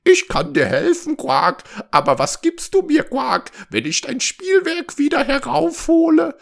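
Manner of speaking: high, loud frog voice with quacks; repulsive frog. A narrator's reading. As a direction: bargain in a squawking creature voice. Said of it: "antwortete der abartige Frosch". (abartiger Frosch, hoch und quakend)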